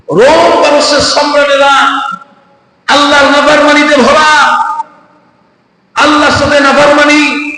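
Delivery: high, ringing preacher's denunciation; impassioned preaching. Retold or imitated. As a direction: call out each phrase high, like an indignant preacher. Impassioned preaching in Umar's words. (উঁচু গলায় আবেগী ওয়াজ)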